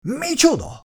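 very high, indignant exclamation; a yelp of outrage, not much volume; outraged yell. A narrator's reading. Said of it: "ordította Bertók". (felháborodott, magas kiáltás)

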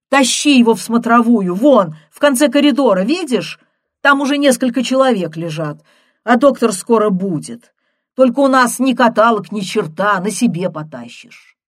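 loud, fast bossy nurse; loud instructions. Acted. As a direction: bark directions down the corridor. Nurse shouting directions.

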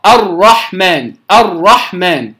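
الرحمن with one light tap on the ra, slowly; single-tap ra. Shown as a demonstration. Teacher demonstrating the correct single-tap ra, slow. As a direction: tap the ra of الرحمن just once, unhurried. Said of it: "فأقول الراء".

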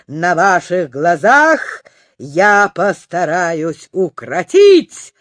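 loud, grand showman's announcement; booming circus ringmaster. Acted. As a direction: proclaim it to the whole circus tent. Professor announces death-defying act.

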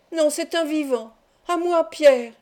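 defiant cry for help. A narrator's reading. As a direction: answer back, then call out for rescue. (réplique puis appel au secours)

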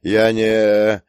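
loud drawn-out helpless bleat. A narrator's reading. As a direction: trail out a bewildered bleat. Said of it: "проблеял он". (растерянное протяжное блеяние)

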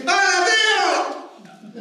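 high, very drawn-out hello; cheerful greeting. Retold or imitated. Nephew on screen greeting his uncle, high and drawn out. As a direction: stretch the hello high and bright.

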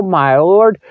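ordinary delivery

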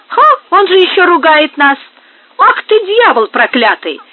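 furious at being scolded back; angry exclamation. A narrator's reading. Workers rage at Benito before the fistfight, loud angry cry. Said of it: "воскликнули работники".

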